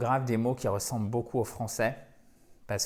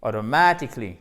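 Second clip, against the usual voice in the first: AUTOMATICALLY with MA punched louder; rythme accentué; 3 semitones lower, 4 dB louder than usual. Shown as a demonstration. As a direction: land hard on MA in "automatically", then drop. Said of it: "Il y a vraiment un rythme". (automatically rythmé, MA bien accentué)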